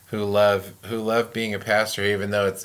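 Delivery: ordinary delivery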